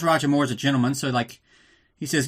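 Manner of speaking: normal talking voice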